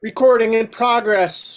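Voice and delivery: high, loud, slow nasal announcement; nasal robot voice. Retold or imitated. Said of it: "the really nasally voice".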